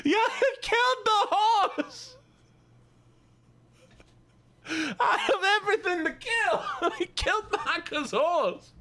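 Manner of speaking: high, drawn-out Southern wail through laughter; slow, high-pitched hammed-up Southern outcry, cracking up. Streamer mock-lamenting a killed horse to chat, high Southern voice. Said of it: "we're done with the Southern Voice".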